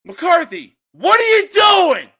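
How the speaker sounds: high, loud exasperated shout at the coach; angry outburst. Real, not acted. Host yelling at the Cowboys coach, exasperated shout. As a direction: yell in disbelief at McCarthy. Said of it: "I apologize for my outburst".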